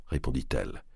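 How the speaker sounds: usual speaking voice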